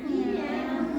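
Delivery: loud meow; cat meow. Shown as a demonstration. Teller shows listeners how a cat sounds. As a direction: call out a meow. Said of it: "What sound does cat make?".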